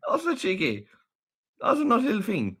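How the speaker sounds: high, adoring mock telling-off; playful scolding. Real, not acted. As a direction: chide a naughty pet with delight. Playfully scolding monkey on video.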